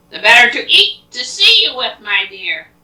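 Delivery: loud, high swell easing on the last words; storybook wolf booming out his answer; big bad wolf. Wolf's menacing reply to Red.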